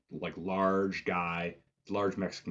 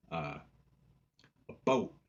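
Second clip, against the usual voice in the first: ordinary talk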